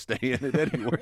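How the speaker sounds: fast, laughing through the words; real laugh breaking into the line. Real, not acted. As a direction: chuckle at your own bad luck as you rush it out. Guest laughs at his own story.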